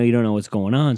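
normal talking voice

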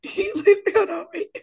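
high, fast, through laughter; can't get the words out for laughing. Real, not acted. Laughing, excited interruption.

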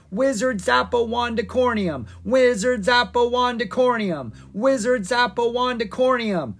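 high, loud rhythmic chant; cheering. A narrator's reading. Townsfolk hailing their wizard hero, cheering. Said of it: "the crowd cheered".

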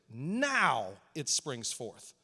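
low, very slow scripture reading; slow emphatic reading. Real, not acted. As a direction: lay every word down slowly.

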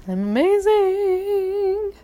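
high, stretched-out self-congratulation; playful boast. Pretend. Celebrating the toilet repair, singsong high boast. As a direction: draw it way out, high and showy.

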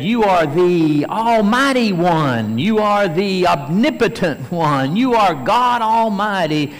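loud, high acted praise to Jesus; voicing Thomas. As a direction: proclaim it as Thomas might have. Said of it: "he could have said".

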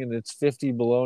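usual speaking voice

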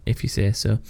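whispered under the breath. Real, not acted. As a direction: mutter it aside, unconvinced. Doubting a friend's claim.

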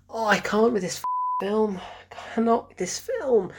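ordinary delivery

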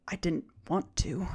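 soft, understated confession; quiet. Acted. Crying old woman confessing to the visitor, quiet. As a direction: say it quietly through a character's tears, lightly acted. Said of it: "she quietly says".

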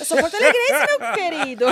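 loud, slow indignant retort. Retold or imitated. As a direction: set him straight, offended and loud. Indignant reply to Twitter user.

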